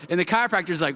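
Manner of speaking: ordinary talk, nothing marked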